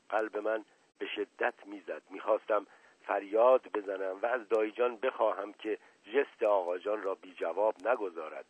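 usual speaking voice

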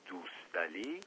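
very hushed, rising question; choked, stifled voice. A narrator's reading. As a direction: barely get the name out, half-strangled and soft. (با صدای خفه و آهسته)

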